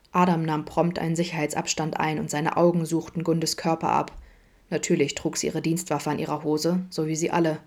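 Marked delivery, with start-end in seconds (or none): none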